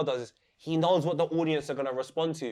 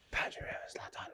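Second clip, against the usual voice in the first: soft awed whisper; 16 dB quieter than usual. Real, not acted. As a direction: breathe the names like a dream matchup. Dreamy fight announcement.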